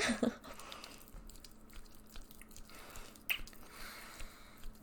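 a drawn-out whispered laugh; long breathy laugh. Acted. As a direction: laugh quietly, savouring it. Teasing partner.

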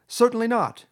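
high, loud flat-out refusal; emphatic. A narrator's reading. Toad refusing to give up motor cars, emphatic. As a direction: refuse at full voice. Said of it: "replied Toad emphatically".

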